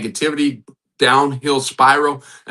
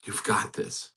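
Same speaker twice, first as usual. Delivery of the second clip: whispered encouragement; 12 dB quieter, slower than usual. Real, not acted. Encouraging aside.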